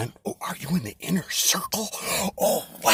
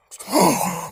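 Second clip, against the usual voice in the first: gasp; 6 semitones higher, 10 dB louder than usual. Acted. Mock amazed listener reacts.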